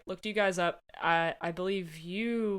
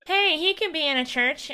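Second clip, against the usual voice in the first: higher, louder voice of an offended commenter; mock indignant lady; 9 semitones higher, 7 dB louder than usual. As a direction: play up a prim lady scolding online.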